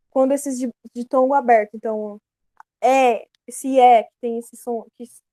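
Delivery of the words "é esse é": loud, drawn-out open é; open É sound. Shown as a demonstration. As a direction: sound out é as an open, acute-accented vowel. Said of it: "lembra esse som de acento agudo".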